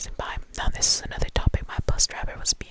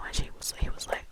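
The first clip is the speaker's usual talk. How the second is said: usual speaking voice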